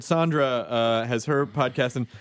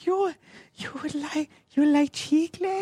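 put-on much higher, slow kid seller voice; Chicles kid voice; 12 semitones higher, slower than usual. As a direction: pitch way up like a little street kid. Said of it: "when I do the Chicles kid".

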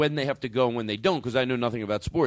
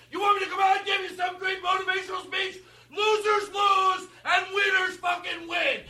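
very high screaming rage, words stretched; furious coach breakdown. Acted. Coach losing his mind at his losing players, screaming. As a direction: snap completely and scream at the team.

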